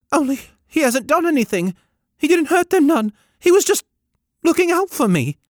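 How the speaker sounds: put-on high, loud small boy announcing his name; boy calling out his name, high and loud; boy's high eager voice. A narrator's reading. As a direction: pipe up proudly as a young lad.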